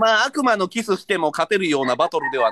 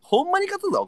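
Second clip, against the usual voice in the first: much higher fired-up cry; excited yell; 14 semitones higher than usual. Real, not acted. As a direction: pump yourself up to win. Determined to win.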